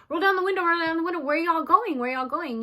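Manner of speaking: high, loud "Roll down the window"; calling out to a car. Retold or imitated. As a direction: stop the car and demand where they're going.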